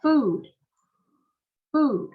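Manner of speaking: food with the oo stretched out; long u. Shown as a demonstration. Teacher models food for the class, long stretched vowel. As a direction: draw out the vowel in food, loud and clear. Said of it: "Mình kéo dài lên".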